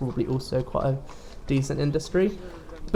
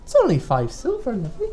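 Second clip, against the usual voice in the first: sly mysterious shopkeeper; 7 semitones higher than usual. Acted. Toy seller.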